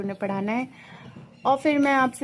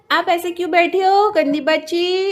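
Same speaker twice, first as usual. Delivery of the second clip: playful pet scolding; 6 semitones higher, 6 dB louder than usual. Pretend. Play-scolding the sulking cat.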